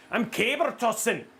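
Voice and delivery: high, hammed-up Scottish brogue; exaggerated Scottish accent, building to a shout. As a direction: strain like an overworked Scottish ship's engineer. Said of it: "Maybe he's Scottish".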